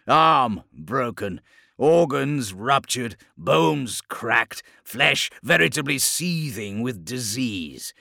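loud, slow, grand villain speech; monstrous warlord, admiring. A narrator's reading. Chaos lord appraising the broken captain, monstrous admiration. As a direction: savour each injury with booming relish.

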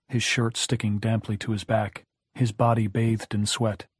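usual speaking voice

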